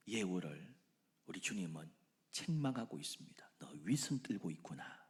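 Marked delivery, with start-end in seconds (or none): 3.6-5.0 whispered, grave reproach; hushed rebuke. Acted. The Lord rebuking Jehu, whispered.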